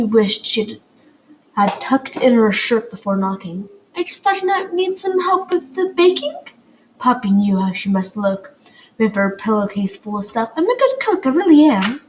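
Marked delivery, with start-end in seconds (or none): 4.0-6.4 put-on girl voice. A narrator's reading. Poppy asking nervously. 10.6-12.0 put-on girl voice. A narrator's reading. Poppy insisting eagerly.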